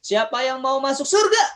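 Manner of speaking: high, loud rallying call. Real, not acted. Teacher rousing children who lack spirit, spirited call. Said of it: "lebih semangat".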